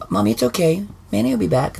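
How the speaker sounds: put-on high, small child's voice. Dad quoting his little daughter, high child voice. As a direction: lightly play the little daughter. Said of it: "Scarlett comes up and goes".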